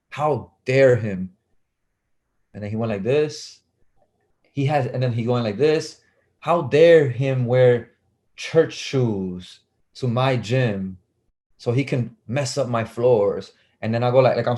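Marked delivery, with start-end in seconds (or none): 0.1-1.3 slow, drawn-out mock outrage; imitated indignant coach. Coach shaming a kid before tryouts, slow indignation. 6.4-13.5 very slow, deliberate mock reprimand; imitated indignant coach. Coach shaming kid over church shoes, slow indignation.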